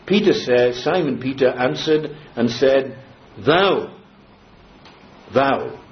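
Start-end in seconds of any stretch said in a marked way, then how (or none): 3.4-5.8 THOU set apart by pauses, falling tone; emphatic THOU. Shown as a demonstration. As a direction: give "Thou" its own weighty beat. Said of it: "very emphatically".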